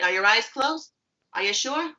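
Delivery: ordinary talk, nothing marked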